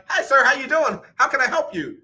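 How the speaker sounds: high, sing-song phone rep greeting; mock chipper customer service voice. Mimicking the phone agent who finally picks up, chirpy. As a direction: imitate the cheery agent answering. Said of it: "they're like".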